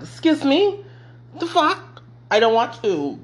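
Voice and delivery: high indignant excuse me; hammed-up startled protest; mock shocked. Retold shocked reply.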